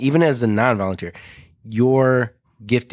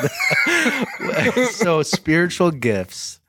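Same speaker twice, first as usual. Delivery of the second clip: slow, high, through laughter; laughing; 7 semitones higher, much slower than usual. Real, not acted. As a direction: laugh the words out slowly. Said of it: "laughing it off".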